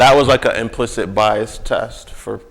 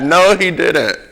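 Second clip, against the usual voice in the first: laughing disbelief; 8 semitones higher, 5 dB louder than usual. Real, not acted. Reacts laughing to shocking story.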